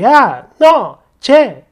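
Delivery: loud, curt call-out; dismissive mother-in-law. Acted. Mother-in-law addressing her daughter-in-law, rude and dismissive. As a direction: throw each word out rudely. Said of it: "이렇게 부르거나 표현을 했어요".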